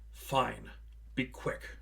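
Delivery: soft, whispered give-in; reluctant sigh. A narrator's reading. Hannah giving in after the argument, whispered and weary. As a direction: let it go with a weary breath. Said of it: "a long sigh from Hannah".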